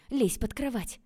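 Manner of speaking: loud, high-pitched command; panicky, forceful exclamation; urgent order. A narrator's reading. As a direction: boss them into hiding, fast. Urgent order to hide from father.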